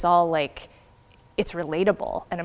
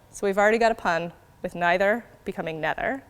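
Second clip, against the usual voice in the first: normal talking voice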